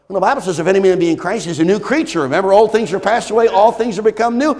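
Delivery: loud, high, driving preacher; full-force preaching. Acted.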